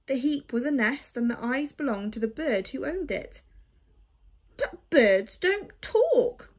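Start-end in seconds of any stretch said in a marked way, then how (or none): 4.6-6.5 slow, loud, higher protest. Acted. Boy protesting to a talking bird, loud and slow. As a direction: insist on it, drawn out. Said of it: "protested Oliver".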